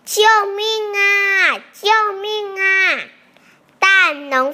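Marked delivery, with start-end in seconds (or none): 0.1-3.0 high, loud, drawn-out cry for help; full-strength shout. A narrator's reading. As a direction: call across the fields, stretching each word. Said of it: "使出最大的力气来喊".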